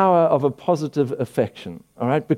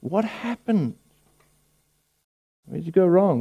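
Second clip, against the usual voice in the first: mock bewildered; 3 semitones higher than usual. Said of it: "And we're like".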